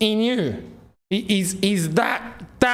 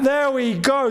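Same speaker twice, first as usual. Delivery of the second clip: usual speaking voice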